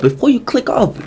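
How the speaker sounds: playful whiny-high objection; mock pleading. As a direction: plead comically in a thin high voice. Pleading with viewers not to leave.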